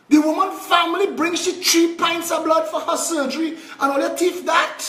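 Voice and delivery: high, strained cry of outrage; outraged disbelief. Real, not acted. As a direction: let the indignation crack the voice upward. Outraged at stolen blood.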